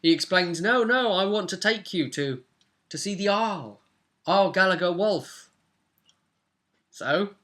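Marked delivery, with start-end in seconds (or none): none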